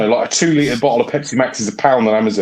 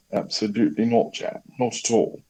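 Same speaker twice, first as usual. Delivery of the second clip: put-on posh accent; 2 semitones lower, 7 dB quieter than usual. Denying to callers being posh, in a posh accent. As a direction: deny it in a posh voice. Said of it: "they think I've got a rather posh accent".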